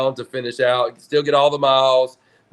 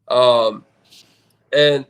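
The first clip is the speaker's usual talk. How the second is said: ordinary talk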